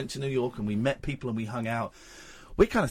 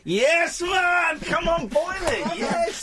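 excited cheer; much slower than usual. Real, not acted. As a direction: celebrate like you just won. Celebrating getting on the show.

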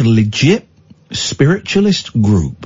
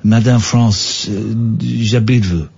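hammed-up French accent, halting; comic Frenchman fumbling his French. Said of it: "in my French accent".

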